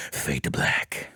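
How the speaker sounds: whispered, like a film trailer closing; dramatic whisper. Retold or imitated. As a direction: breathe it out as the scene fades. Dramatic narration of game scene.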